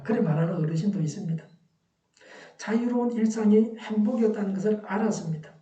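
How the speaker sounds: ordinary talk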